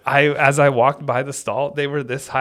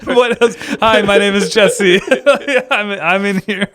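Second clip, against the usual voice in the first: high, loud, giggly mock reply; laughing joke lines; 7 semitones higher, 6 dB louder than usual. Joking with friend about stall replies, laughing. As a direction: play at answering from a stall.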